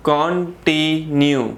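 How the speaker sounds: continue slowly broken into con-ti-nue; three syllables. Shown as a demonstration. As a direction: sound out continue in three drawn-out beats. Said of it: "three syllables होते हैं".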